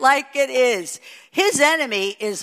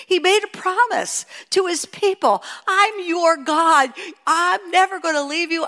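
higher, ringing sermon delivery; impassioned preaching; 8 semitones higher than usual. Acted. Preacher voicing God's covenant promise to his people, impassioned. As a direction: proclaim the promise with fervour.

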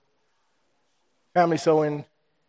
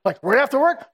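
eager question; 9 semitones higher, 5 dB louder than usual. Retold or imitated. Craig pressing to talk.

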